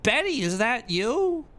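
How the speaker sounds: put-on very high, slow breathy-free girly voice; hammed-up high girly voice asking for Betty; mock girly message. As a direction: squeal it like a fake anime girl. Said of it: "sending me messages like".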